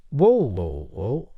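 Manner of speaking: mildly startled "whoa"; mild exclamation. A narrator's reading. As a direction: exclaim it lightly, as if halting someone. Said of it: "Bradley exclaimed".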